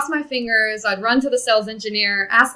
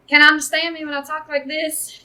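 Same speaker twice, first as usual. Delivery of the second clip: higher, louder Texas accent; 6 semitones higher, 5 dB louder than usual. Shown as a demonstration. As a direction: put on a Texas accent to demonstrate. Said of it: "I had a Texas accent".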